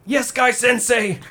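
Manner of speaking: high eager slowed shout; eager student shout. Pretend. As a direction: cry it out like a devoted pupil. Voicing Lee eagerly.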